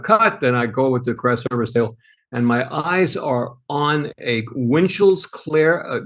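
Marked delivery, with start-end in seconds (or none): 0.0-0.4 high-pitched mock yell of a director; retold director's yell. Storyteller imitates the director ending a scene, mock yell. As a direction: imitate a director calling out, without full volume. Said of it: "yells out".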